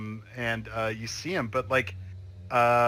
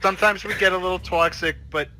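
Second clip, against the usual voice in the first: wife on the phone; 4 semitones higher, 6 dB louder than usual. Acted. Wife pleading loudly on phone.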